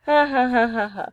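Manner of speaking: mock laughter; forced laugh. Real, not acted. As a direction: laugh it out in separate, deliberate beats. Reacts to the death ending.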